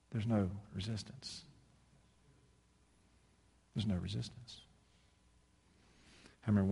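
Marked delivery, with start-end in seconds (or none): 0.1-1.4 quiet solemn. Real, not acted. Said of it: "repeated closing line". 3.7-4.6 quiet solemn. Real, not acted. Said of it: "repeated closing line".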